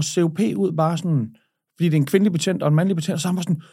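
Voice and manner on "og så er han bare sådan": usual speaking voice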